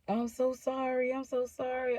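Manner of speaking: retold frightened apology, pitched high; scared pleading. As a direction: plead as if reliving the fear. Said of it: "I was so scared".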